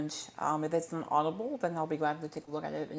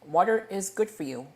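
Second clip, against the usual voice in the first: ordinary talk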